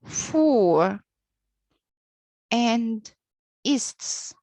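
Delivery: FORESTS broken into "for" and "ists"; syllable-by-syllable teaching demo; split into syllables. Shown as a demonstration. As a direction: say "for", pause, then "ists", each separately. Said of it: "two syllables".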